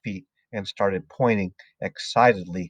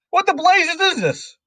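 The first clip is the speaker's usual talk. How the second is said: very high, loud Trunchbull shout; outraged yell; 6 dB louder than usual. A narrator's reading. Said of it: "yelled the Trunchbull".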